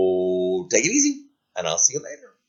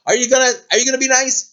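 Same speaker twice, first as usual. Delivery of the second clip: higher, fast, pushing knight; demanding knight; 12 semitones higher, 5 dB louder, faster than usual. Acted. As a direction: press him for a promise.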